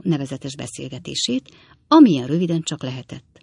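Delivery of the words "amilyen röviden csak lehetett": usual speaking voice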